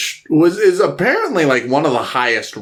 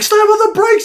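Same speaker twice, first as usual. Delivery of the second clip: very high, louder panicked yell; mock panicked shout; 4 dB louder than usual. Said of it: "they're like".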